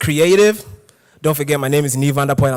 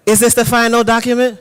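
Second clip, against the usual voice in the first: higher mock client repeating the question; higher hammed-up client voice; exaggerated client; 8 semitones higher than usual. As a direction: ham up the clueless client. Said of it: "and says".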